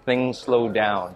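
dragged out in slow motion. Shown as a demonstration. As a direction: stretch every word out slowly. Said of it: "Slow-mo syrup".

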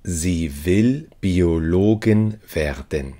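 ordinary talk

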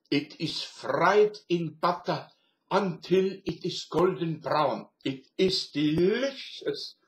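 slow, loud put-on German accent; fake German accent. Doorman answering New York lady, slow fake German accent. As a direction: play a German deli doorman explaining sausages. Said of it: "speak with a fake German accent".

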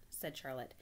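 plain reading voice

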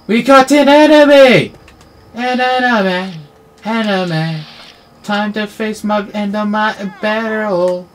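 high, loud alarm; excited shout. Real, not acted. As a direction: call it out, thrilled. Spots an enemy, shouts.

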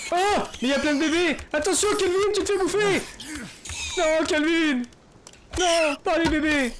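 high, loud alarmed cries for Kelvin; panicked yelling. Real, not acted. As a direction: shout in panic as your buddy gets mobbed. Panics as babies attack Kelvin.

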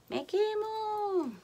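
high, slow, drawn-out wail of pain; getting burned. Real, not acted. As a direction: stretch it into a high whine.

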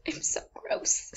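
hushed, breathy confession; a sheepish whisper to the camera. Real, not acted. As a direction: whisper it, embarrassed. Embarrassed aside to camera.

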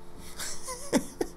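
high silent wheeze of a laugh; a scornful airy laugh; breathy mocking laugh. Real, not acted. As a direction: laugh at him without voice. Mocking caller.

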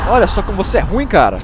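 high, loud mocking jeer; loud taunt. Real, not acted. Gamer gloats at a beaten enemy, loud taunt. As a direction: rub it in as you gloat.

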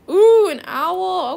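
slow, loud, drawn-out delighted gasp; savouring an owl on offer; excited ooh. Real, not acted. Excited over an owl offered in trade, loud and slow.

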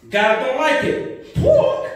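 ordinary talk

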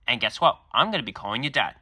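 ordinary delivery

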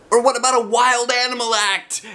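put-on high, loud kid pitch; eager boy. A narrator's reading. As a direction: pitch the idea like an excited boy. Roger voiced.

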